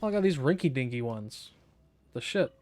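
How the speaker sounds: usual speaking voice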